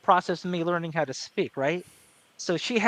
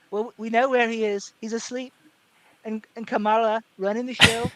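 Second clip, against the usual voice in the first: higher-pitched Trump aside; knowing Trump jab, pitched up; Trump impression; 4 semitones higher than usual. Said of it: "that's kinda how he talks".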